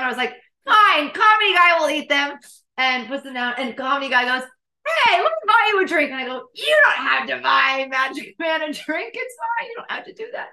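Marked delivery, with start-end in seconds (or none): 0.6-2.4 high, loud, put-on giving in; loud acted-out huff; exasperated retort. Acts out exasperated reply. 5.0-6.1 fast, high, loud impersonation; hammed-up enthusiastic date; eager offer. Acts out date's eager offer. 6.5-10.5 high, strained mock shouting; hammed-up flustered squeal; panicked protest.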